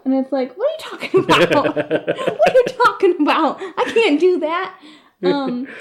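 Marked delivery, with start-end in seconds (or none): 0.6-4.7 higher-pitched, laughing disbelief; mock exasperation. Fan reacting to the author's useless answer, laughing exasperation. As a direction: protest incredulously through laughter.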